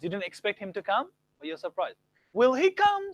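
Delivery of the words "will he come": much higher, louder rising question. Shown as a demonstration. Teacher contrasts surprise with question, much higher. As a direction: ask it high and lifting. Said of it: "Same tone, but then it's a question".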